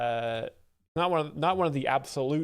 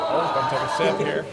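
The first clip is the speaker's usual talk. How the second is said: laughing; 2 semitones higher, 7 dB louder, faster than usual. Real, not acted. Laughing after dark joke.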